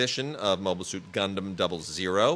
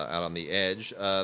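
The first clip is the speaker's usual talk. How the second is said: ordinary talk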